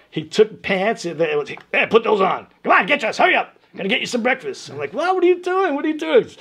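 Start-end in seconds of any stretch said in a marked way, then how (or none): 1.7-4.7 high, loud imitated orders; hurrying teacher. Retold teacher hurrying the kid. 4.9-6.3 very high, loud mock protest; bewildered kid. His kid self bewildered at teacher.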